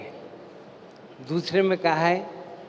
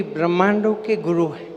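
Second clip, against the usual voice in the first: ordinary talk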